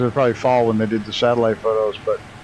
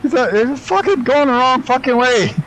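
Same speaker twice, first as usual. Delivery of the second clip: much higher, half-laughing frustration; exasperated outburst; 12 semitones higher, 3 dB louder than usual. Real, not acted. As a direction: throw it up high in exasperation. Streamer realises he flew wrong way.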